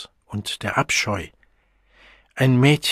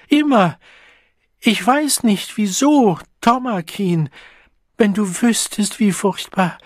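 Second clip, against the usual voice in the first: sobbing; 9 semitones higher, 5 dB louder than usual. A narrator's reading. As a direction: plead through sobs. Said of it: "schlug die Hände vors Gesicht und schluchzte".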